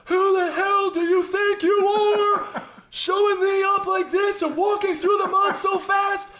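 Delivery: high, slow, furious shout; captain's angry yell. Retold or imitated. Captain yelling at the recruit for showing him up. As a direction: bellow at the recruit like an outraged captain. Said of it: "the captain walking over and go".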